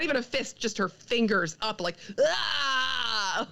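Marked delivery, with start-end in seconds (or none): 2.2-3.5 mock furious yell, pitched up. Retelling Gamora's one-handed fury as a mock yell. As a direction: let out a hammed-up battle cry. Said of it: "just in fury".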